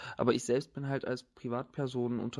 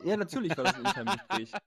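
fast giggly reply; laughing; 5 semitones higher, 3 dB louder, faster than usual. Real, not acted. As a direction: answer while laughing.

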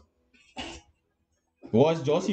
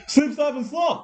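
much higher, louder chanted slogan; sing-song slogan; 11 semitones higher, 3 dB louder, slower than usual. Shown as a demonstration. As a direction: belt the sun-safety jingle up high. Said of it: "Fuck off".

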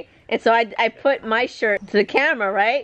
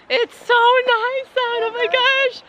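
delighted exclaim; 13 semitones higher than usual. Real, not acted. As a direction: savour the spring day. Delighted by weather.

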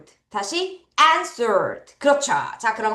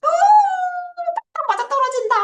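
excited; much faster than usual. Real, not acted. Excited teacher.

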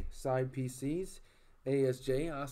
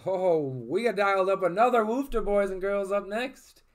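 loud, high hype call; excited hype; 6 semitones higher, 9 dB louder than usual. Real, not acted. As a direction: shout it out like a hype man. Excited pack-break hype call.

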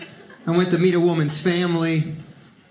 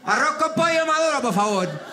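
higher, fast Cuban Spanish; extra Cuban accent; 8 semitones higher than usual. Pretend. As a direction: overplay being Cuban. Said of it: "I gotta be extra Cuban".

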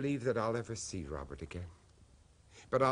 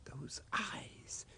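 much softer, breathy whisper; awed whisper; 4 semitones higher, 11 dB quieter than usual. Acted. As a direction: let the words barely escape, spooked. Haunted memory of the eyes.

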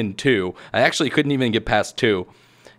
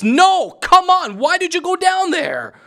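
high, loud wail of protest; frustrated gamer outburst, pitched way up; exasperated yell; 5 dB louder than usual. Real, not acted. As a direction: yell at the game character for failing. Crashes in the game.

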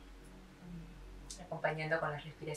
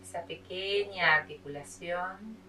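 measured meditation voice with long pauses; unhurried yoga teacher leading a body scan; guided meditation. Acted.

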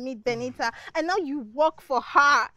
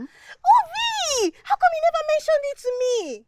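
high, loud wail of complaint; indignant outcry; 11 semitones higher, 6 dB louder than usual. Acted.

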